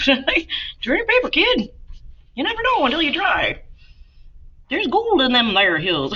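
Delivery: a goofy prospector twang; playful put-on voice for the class; silly voice. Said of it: "I don't know why I'm talking like that".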